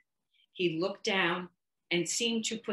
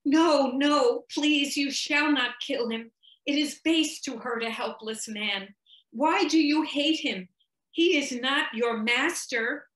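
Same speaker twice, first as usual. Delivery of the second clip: much louder, higher desperate plea; crying out; 7 semitones higher, 5 dB louder than usual. A narrator's reading. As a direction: cry out to stop him killing the man.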